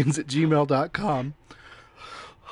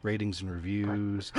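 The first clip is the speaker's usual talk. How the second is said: ordinary talk, nothing marked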